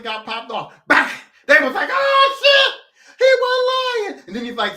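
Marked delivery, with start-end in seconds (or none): 1.9-4.3 high, loud, slow scared outcry; panicked shout. Retold or imitated. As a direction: shriek it in sudden terror. Players panic after first shooting.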